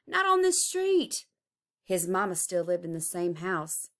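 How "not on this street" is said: high, loud cry of protest; a boy crying no; cried out. Acted. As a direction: shout it to stop them. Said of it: "cried Tyree".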